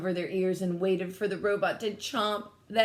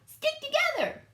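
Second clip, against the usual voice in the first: very high urgent alien call; squeaky call to her brother; Bork crying out. A narrator's reading. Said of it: "cried Bork".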